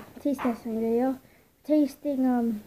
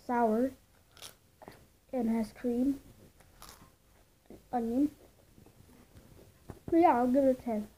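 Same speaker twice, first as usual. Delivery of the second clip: biting chip between words; chewing while speaking; mouth full of chips; 6 dB quieter than usual. Real, not acted. Seen 2: chip held at lips, biting and chewing while talking.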